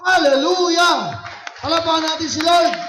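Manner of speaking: high, full-force hallelujah shout; jubilant shout. Real, not acted. Preacher leading the church to applaud the Lord, jubilant shout. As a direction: shout praise and call for applause.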